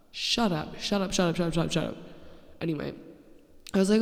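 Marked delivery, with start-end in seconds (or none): 0.1-2.0 rattled off, piling up repeats; exasperated retort. Retold or imitated. Podcaster fed up with tattoo compliments, exasperated rapid retort. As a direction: fire it off over and over, exasperated.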